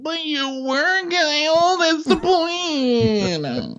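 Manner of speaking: very high, drawn-out wail of protest; hammed-up squealing complaint; mock whining. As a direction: stretch each word into a high, slow whine. Acted quarrel, Amanda to Kyle.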